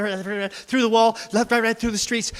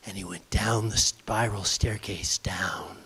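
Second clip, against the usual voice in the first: whisper; 11 semitones lower, 7 dB quieter than usual. A narrator's reading. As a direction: whisper it down into the dark. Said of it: "narration whispered descent".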